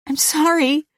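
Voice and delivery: high-pitched, horrified apology; shocked apology. A narrator's reading. Louise just after slapping Mister Carter, aghast.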